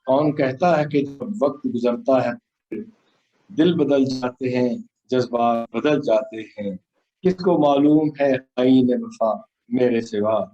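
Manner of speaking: solemn, measured poem delivery; stately nazm recitation. Acted.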